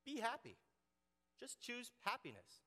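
high, sing-song cheerful; mock cheery advice. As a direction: chirp it like a shallow optimist. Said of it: "Or how about this?".

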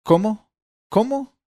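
high, loud angry repeated "¿Cómo?"; somewhat angry. Acted. Old father snapping at his son, angry. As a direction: bark it out, losing patience. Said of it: "un tanto encolerizado".

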